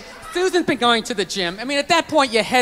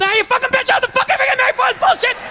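high, fast mock tirade; sudden snap; 12 semitones higher, faster than usual. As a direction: imitate dad exploding out of nowhere. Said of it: "you just snap".

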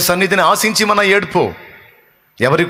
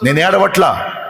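fiery preaching; 4 semitones higher than usual. Acted. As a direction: preach it at full force, driving each line home. Preaching Mary's lament crescendo. (ఉద్వేగంతో గట్టిగా బోధించడం)

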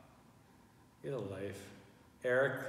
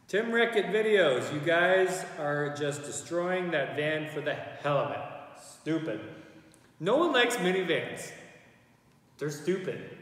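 loud, slow, high hater voice; mocking hater voice; 5 semitones higher, 6 dB louder, slower than usual. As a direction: ham up a dumb troll. Reading a hater comment aloud.

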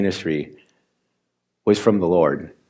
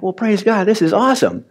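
joyful exclaim; 10 semitones higher than usual. Retold or imitated. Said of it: "and I'm like".

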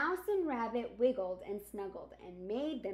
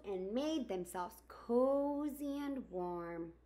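gentle storybook narration; reading to children; 4 dB louder than usual. Acted. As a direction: read it like storytime for kids. Reads picture book aloud.

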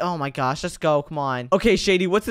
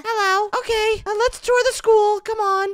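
put-on high falsetto girly voice; man doing a woman's voice; 14 semitones higher than usual. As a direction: squeak it up high like a girl. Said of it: "Get your girl voices ready".

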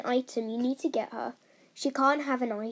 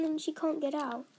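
ordinary talk

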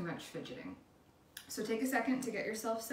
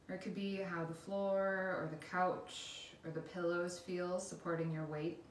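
guided meditation; 5 dB quieter than usual. Acted. Instructor leading listeners through a body scan, calm guided meditation.

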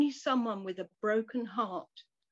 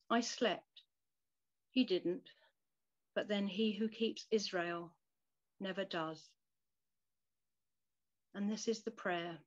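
normal talking voice

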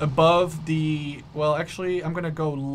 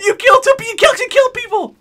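high, loud disbelieving shout; shocked excited yell; 10 dB louder than usual. Real, not acted. Teammate kills enemies.

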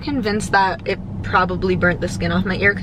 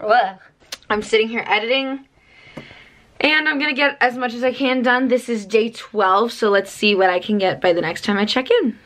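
ordinary delivery

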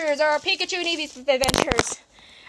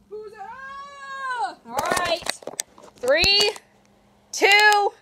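ordinary delivery

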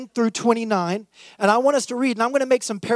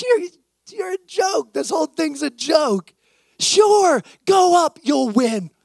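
scornful mock shout, much higher; 7 semitones higher than usual. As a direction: sneer it out, you're a joke. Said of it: "He's like".

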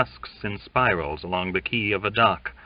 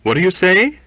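higher, louder anxious say; more anxious, pressing; 12 semitones higher, 9 dB louder than usual. Acted. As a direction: press the question urgently. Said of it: "inquired Waite anxiously".

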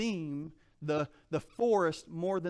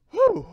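winded whew; 12 dB louder than usual. Real, not acted. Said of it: "I'm out of breath now".

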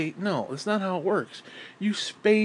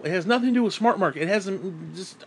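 normal talking voice

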